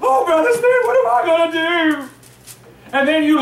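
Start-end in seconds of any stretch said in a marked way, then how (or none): 0.0-2.0 much higher, whining fretful caller; mock a frantic church member on the phone; panicked caller. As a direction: pastor mocks a frightened member calling him, high panicked whine. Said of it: "You call me up on the phone".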